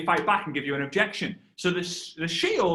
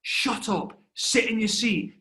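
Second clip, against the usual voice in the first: higher, slow, emphatic plea; urgent hushed scolding; 4 semitones higher, slower than usual. Retold or imitated. Thirteen-year-old telling his dad to sit down, urgent scolding. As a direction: scold dad under pressure, word by word. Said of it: "And I'm like".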